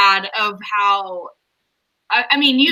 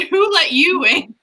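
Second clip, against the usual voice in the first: snarky; 6 semitones higher, slower than usual. Retold or imitated. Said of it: "We're so snarky".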